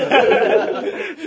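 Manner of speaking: squealing laugh; high laugh. Real, not acted. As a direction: burst out laughing. Laughing at guest.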